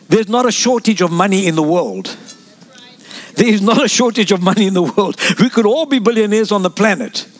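normal talking voice

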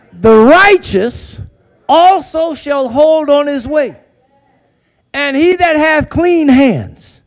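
drawn-out, weighty reading of the verse; slow solemn verse; slow emphatic scripture. Shown as a demonstration. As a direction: lean on every word slowly. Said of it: "say it like you mean it".

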